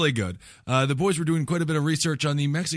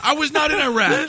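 high, indignant protest; mock-outraged caller setting it straight; indignant denial; 11 semitones higher than usual. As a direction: protest with pitch jumping up. Indignant caller in sex-line sketch.

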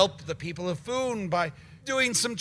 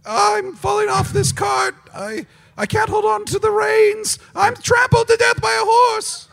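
put-on high screaming panicked child; 6 dB louder than usual. Shown as a demonstration. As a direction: shriek it like a terrified kid falling. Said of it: "And now a child?".

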